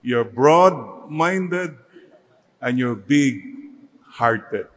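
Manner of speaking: every word spaced out slowly; slow, weighty sermon emphasis. Shown as a demonstration. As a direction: drive the point home word by word. Preacher stresses key virtues slowly.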